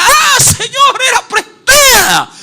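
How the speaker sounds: very high, loud, drawn-out wail; acted shout of alarm and dismay; instinctive cry. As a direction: scream it slowly, as a gut reaction. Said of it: "de manera intuitiva dirá".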